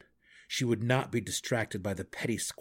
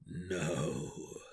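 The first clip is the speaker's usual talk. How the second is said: low, soft and shaky; 5 semitones lower, 6 dB quieter than usual. Acted. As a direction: let the voice tremble. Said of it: "replied in a shaky voice".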